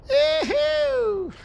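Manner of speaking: squeaky high Mario cheer; Mario yee-hoo. Retold or imitated.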